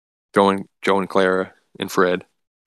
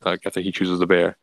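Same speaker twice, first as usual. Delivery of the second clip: usual speaking voice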